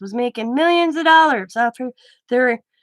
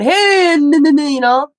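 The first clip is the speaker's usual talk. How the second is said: high, loud call of hey; loud mock shout of a teen greeting; 6 semitones higher, 7 dB louder than usual. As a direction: shout it like kids greeting a buddy. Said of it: "and they went".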